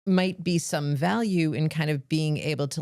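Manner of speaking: ordinary delivery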